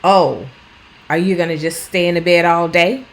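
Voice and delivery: sarcastic carer mocking the bedridden person; sarcastic jab. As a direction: needle them with sarcasm. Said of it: "Don't say things like".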